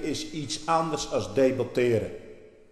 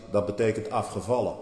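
ordinary delivery

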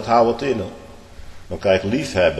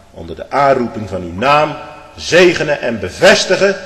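louder and higher on "zegenen en bevestigen"; prayer swelling to fervour; 6 semitones higher, 10 dB louder than usual. Acted. Preacher voicing a husband's prayer, rising in fervour. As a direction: let the prayer build upward.

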